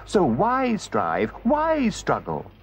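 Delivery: high and very slow; Toad yearning. Acted. Toad talks himself into driving, slow yearning voice. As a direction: stretch each question out in longing.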